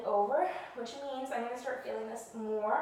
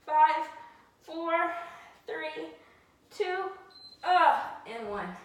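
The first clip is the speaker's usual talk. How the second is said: high, loud strained count; loud countdown; 6 semitones higher, 7 dB louder, much slower than usual. Real, not acted. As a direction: shout the count through the effort. Coach counting down final seconds.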